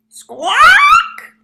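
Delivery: very high, loud laughing parrot squawk; parrot's loud laughing screech; cackling squawk. Acted.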